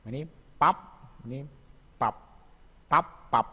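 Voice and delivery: "pap" high and loud, lips shut on the final p; teacher drilling final p in "pap"; batchim demo. Shown as a demonstration. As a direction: punch out "pap" for the students. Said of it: "Contohnya gini".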